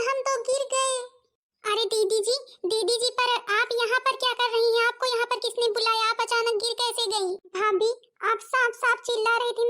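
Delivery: a sister-in-law calling out after her fall, lightly pained; fallen sister-in-law. Acted. Fallen sister-in-law asks her bhabhi what happened, lightly pained.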